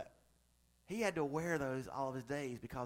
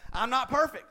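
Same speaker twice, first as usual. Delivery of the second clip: laughing, louder and higher; 8 semitones higher, 8 dB louder than usual. Shown as a demonstration. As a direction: laugh through the line.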